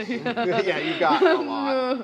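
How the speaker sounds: laughing. Real, not acted. Game master laughing at the player's big roll.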